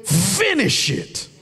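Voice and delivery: scripture cried out high and loud, slow, half sung; chanted gospel preaching, stretched and loud; preaching at full force. Acted. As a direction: build the verse to a sung shout over the music. Preacher reading scripture over music.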